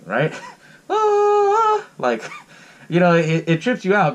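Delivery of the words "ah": high, wailing creepy "ah"; imitated eerie music. Podcaster mimicking the film's weird opening music, high eerie wail. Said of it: "has this weird fucking music playing".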